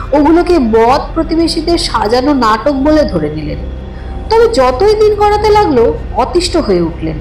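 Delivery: ordinary delivery, nothing marked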